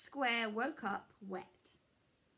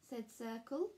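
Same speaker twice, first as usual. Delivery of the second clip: plain reading voice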